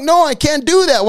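high, emphatic acted refusal; punchy, high-pitched no; firm refusal. Acted answer to drinking offer.

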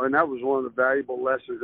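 ordinary talk

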